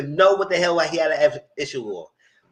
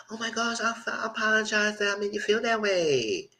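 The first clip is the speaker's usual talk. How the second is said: mimicked apology; 7 semitones higher, 5 dB quieter than usual. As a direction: imitate her apologizing in a higher voice.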